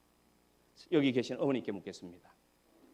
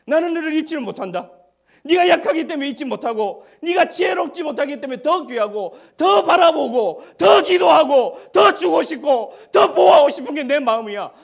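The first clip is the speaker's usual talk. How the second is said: much higher, louder shouted declaration, slowing on the last phrases; God's impassioned reply; 12 semitones higher, 16 dB louder than usual. Acted. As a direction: proclaim God's love at full preaching force. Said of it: "원망할 때 하나님 말씀하십니다".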